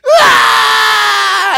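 high, loud wailing scream; hammed-up shrieking wail; anguished wail. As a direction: wail like a heartbroken girl. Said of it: "she's just like".

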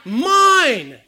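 put-on loud, high childish snatch of the word Mine; greedy child. Little Johnny snatching the toy from Susie, loud childish claim. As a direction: grab it like a toddler claiming a toy. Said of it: "what does he say".

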